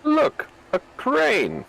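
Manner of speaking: very high, thin squeal; squeaky high voice. Pretend. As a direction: pitch way up like a cartoon. Excited squeal at game crane.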